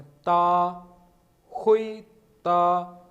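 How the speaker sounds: ता and हुई said high and loud, each rising; loud rising tones. Shown as a demonstration. Teacher drills ता and हुई for class, loud rising tones. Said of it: "वो भी second tone है".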